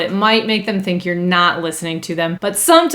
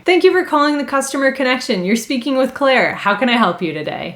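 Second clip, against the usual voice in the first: friendly phone greeting; 4 semitones higher than usual. Shown as a demonstration. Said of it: "let's just hear a good example".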